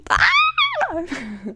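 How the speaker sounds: very high squealing laugh; giddy squeal. Real, not acted. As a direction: squeal with excitement before the reveal. Bursting to reveal what spirits said.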